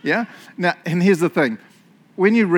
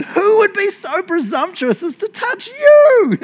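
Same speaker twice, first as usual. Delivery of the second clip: slow, high, very loud hammed-up fury; theatrical royal outrage; outraged demand; 9 dB louder, slower than usual. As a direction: thunder it out as an affronted king, stretching the words. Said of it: "King Xerxes demanded".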